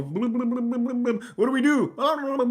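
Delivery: high, very slow dopey voice; dim-witted officials, drawn out and high; clueless officials. Pretend. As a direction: ham up a lost bureaucrat stalling. Mock panicked officials.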